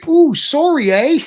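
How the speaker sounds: high, hammed-up Canadian apology; badly faked Canadian with a lifted "eh"; Canadian impression. Imitating Mark's Canadian vernacular, hammed-up apology. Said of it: "Was that a Canadian accent?".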